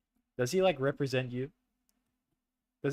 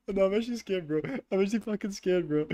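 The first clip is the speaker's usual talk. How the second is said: higher, louder panicked admission; real fear; 7 semitones higher, 3 dB louder than usual. Real, not acted. Gamer admits to friends he is scared, panicked and high. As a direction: say it like you truly mean it, spooked.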